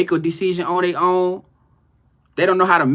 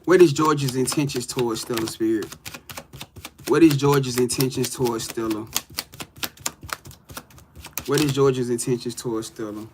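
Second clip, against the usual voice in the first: softer, lower asking of the cards; tarot reading question; 6 semitones lower, 4 dB quieter than usual. Acted. Reader asking spirit about Stella and George. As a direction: ask spirit the question calmly.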